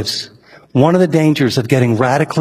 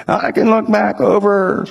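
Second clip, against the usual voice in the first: put-on slower, higher, creaky old man voice; 5 semitones higher, slower than usual. Old architect telling the pastor about his giving, old man voice. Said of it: "he talked like this".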